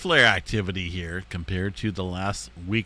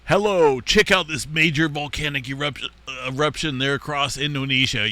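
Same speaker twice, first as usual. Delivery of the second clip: scripted hype intro; 4 semitones higher, 4 dB louder than usual. Retold or imitated. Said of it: "key up my updates with".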